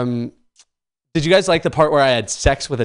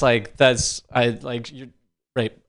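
ordinary talk, nothing marked

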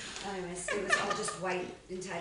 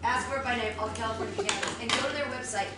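upbeat, louder sponsor pitch; ad read; 3 semitones higher, 4 dB louder than usual. Acted. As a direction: sell it like a radio ad. Reads a sponsor ad.

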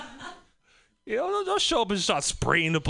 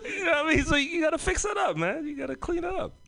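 laughing; 10 semitones higher, faster than usual. Real, not acted. Comic cracks himself up telling the crowd to fix it. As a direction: still laughing, high and breathless.

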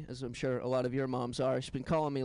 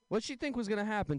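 normal talking voice

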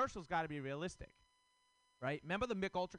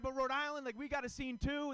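high, eager pitch for Rhode Island; mock self-quote; 10 semitones higher than usual. Comic retelling defending Rhode Island, high and eager. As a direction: sell your hometown a bit too hard. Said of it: "I'm like".

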